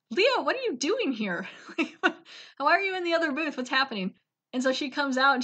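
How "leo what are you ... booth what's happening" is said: high, bewildered questioning; mock astonishment. Wife finds husband in the other booth, mock astonished. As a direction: act out a baffled wife, pitched up.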